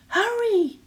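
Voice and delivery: high, loud urgent screech. Acted. Giant's wife urging Jack to run, high screech. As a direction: shriek at the boy to run. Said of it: "screeched the giant's wife".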